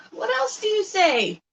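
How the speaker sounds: high coaxing pet voice; talking to the bird. Real, not acted. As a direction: lift it way up, sweetly prompting.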